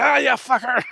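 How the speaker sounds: high, loud mock whoop of triumph; excited whoop. Bitten soldier cheering while fighting the shark, high excited whoop. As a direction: cheer it like a half-bitten man still fighting. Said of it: "He's still like".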